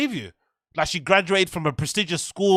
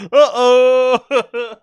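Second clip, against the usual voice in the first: wheezing laugh; 4 semitones higher, 8 dB louder than usual. Real, not acted. Crying with laughter.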